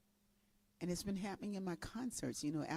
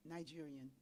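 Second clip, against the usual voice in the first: ordinary talk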